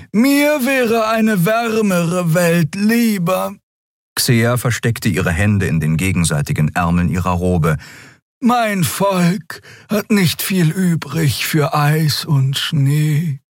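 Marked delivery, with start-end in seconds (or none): 0.1-3.5 much higher, slow, drawn-out otherworldly voice; a cold reptilian creature voicing her dislike of winter; alien Xea voice. Xea complaining to the crew about the cold, high and slow. 8.4-13.4 much higher, slow, drawn-out otherworldly voice; a cold reptilian creature voicing her dislike of winter; alien Xea voice. Xea complaining to the crew about the cold, high and slow.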